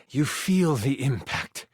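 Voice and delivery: grave storyteller, dropping low at the end; solemn death narration. A narrator's reading. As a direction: narrate a hero's death with long pauses. Game master narrates the player's death.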